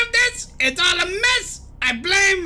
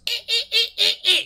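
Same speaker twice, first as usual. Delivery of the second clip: short, repeated high squawks; high staccato bird chirps; bird noise; 4 semitones higher than usual. Acted. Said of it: "That's what he's thinking".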